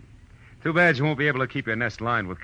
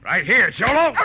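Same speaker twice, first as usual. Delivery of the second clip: loud taunting call; hollered taunt; shout; 8 dB louder than usual. Acted. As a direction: yell it out to lure him in. Calling out to henchman.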